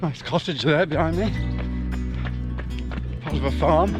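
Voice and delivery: breathless talk mid-jog; talking while out on a run; while running. Real, not acted. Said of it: "let's finish this run off".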